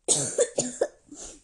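kräftiges, trockenes Husten; laut loshusten; lauter Husten. Real, not acted. (lauter Hustenanfall)

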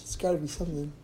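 ordinary delivery

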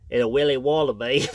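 Australian attempt like a stuffed-up Brit; bad Australian accent. Shown as a demonstration. Dad poking fun at his own bad Australian accent. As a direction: fake Australian, sounding British with a cold. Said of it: "a British guy with a cold".

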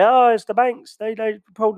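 high mocking complaint; whiny complainer. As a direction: imitate people blaming the banks. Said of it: "people start to complain and say".

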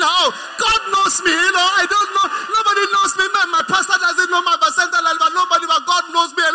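high, whiny self-pity rant; hammed-up high wail of complaint; mock crying complaint. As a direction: imitate a tearful complainer, pitched well up. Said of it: "you are sitting there crying".

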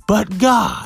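preacher catchphrase. Retold or imitated. Mimicking a pastor's go-to phrase to the church, proclaiming. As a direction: announce it like the big turnaround. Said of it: "They could be talking so negative and say".

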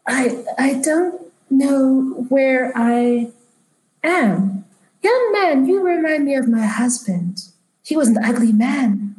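put-on high-pitched, halting old lady voice; confused elderly woman. Lost elderly woman addressing a young man, high and wavering in pace. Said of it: "she looks around and she's like".

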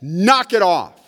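sharp, loud command to stop; a loud, firm knock it off shouted to a coach; loud call. Retold or imitated. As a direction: call out loud and slow across the field. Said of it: "and I said".

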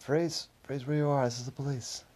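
mild police shout. A narrator's reading. Policeman bursts in, calling out a mild command. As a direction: order them to freeze. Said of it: "a loud voice called out".

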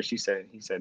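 ordinary delivery

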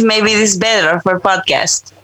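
put-on Russian accent, a bit thick. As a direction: speak in a light mock Russian accent. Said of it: "should I go Russian accent?".

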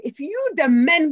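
put-on slow, loud Ghanaian-accented rallying call; Ghanaian accent with emphasis. Lecturer quoting the Ashanti queen mother to the elders, in a slow loud Ghanaian accent. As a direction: lean into a Ghanaian accent and punch each word. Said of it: "I'm gonna say it with accent and emphasis".

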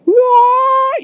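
squealed high, loud outburst of mock rage; imitating Bruce Lee's rage as a sudden high yelp; a hammed-up enraged yelp. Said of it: "He's just like".

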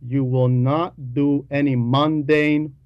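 normal talking voice

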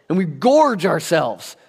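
gorge drawn out slow and heavy; preacher dragging out the word gorge; slow stress on gorge. Real, not acted. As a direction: lean hard on gorge, slowly.

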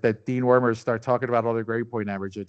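ordinary delivery

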